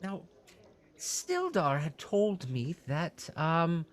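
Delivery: high, eager innkeeper voice; fussy innkeeper. Acted. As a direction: play a flustered, helpful innkeeper pitched high. Innkeeper asks about Sildar.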